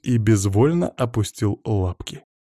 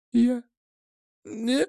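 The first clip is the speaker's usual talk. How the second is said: high, faint, broken-off words; choked; 13 semitones higher, 5 dB quieter than usual. A narrator's reading. Strangled girl tries to answer her attacker, choked. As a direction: try to speak and fail. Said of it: "из неё вышел лишь сдавленный хрип".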